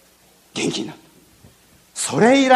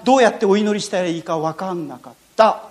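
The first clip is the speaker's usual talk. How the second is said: ordinary delivery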